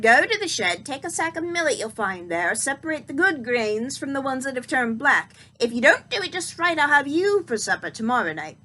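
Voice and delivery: put-on loud, menacing old witch; witch threatening. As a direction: threaten her like a hungry hag. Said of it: "she ordered".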